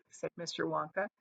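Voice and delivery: ordinary delivery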